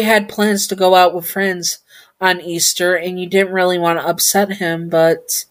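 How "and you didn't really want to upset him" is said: ordinary delivery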